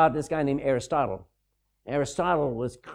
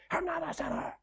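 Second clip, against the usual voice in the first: much higher, softer mock raspy woman's voice; mock her rasp, pitched way up; raspy voice impression; 14 semitones higher, 9 dB quieter than usual. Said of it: "You ought to hear her raspy voice".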